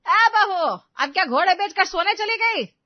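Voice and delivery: loud, high-pitched scolding summons; harsh turn. Acted. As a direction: yell for the daughter-in-law, sweetness dropped. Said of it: "Sujata के सुर बदल जाते हैं". (बदले सुर में चिल्लाकर ताना)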